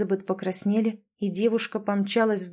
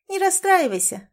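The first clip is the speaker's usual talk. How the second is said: encouraging; 11 semitones higher, 3 dB louder than usual. Acted. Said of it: "ободрила её Лори".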